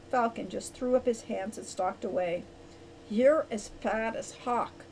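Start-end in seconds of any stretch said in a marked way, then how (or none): none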